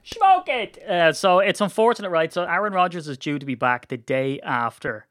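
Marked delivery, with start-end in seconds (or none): 0.0-0.8 shrieked high and loud; high squeal. Real, not acted. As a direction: squeal it out in delight. Echoing taunt, squealed.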